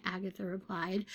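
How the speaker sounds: ordinary delivery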